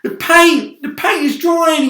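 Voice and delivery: high, loud despairing moan; moaning centipede. A narrator's reading. Centipede moans that the paint is drying, high loud lament. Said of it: "he moaned".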